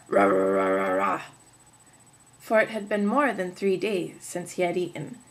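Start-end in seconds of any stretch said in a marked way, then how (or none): 0.1-1.2 gobbling growl. Acted. Wolf devouring.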